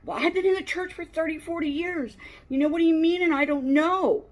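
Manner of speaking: high, fast, indignant mock churchgoer. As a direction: squeak it out like an offended old-timer. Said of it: "They don't wanna feel like".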